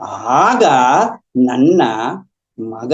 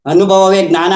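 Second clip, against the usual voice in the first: louder and higher, at normal pace; loud, emphatic maxim; 4 semitones higher, 7 dB louder than usual. Retold or imitated. Repeating the guru's teaching to listeners, loud and emphatic. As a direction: drive the teaching home forcefully.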